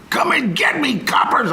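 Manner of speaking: high, defiant yell back at police; cornered outlaw. Retold or imitated. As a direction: taunt the cops from inside the house. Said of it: "I wanted to be that guy".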